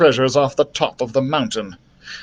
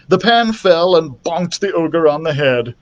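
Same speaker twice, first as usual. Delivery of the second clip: plain reading voice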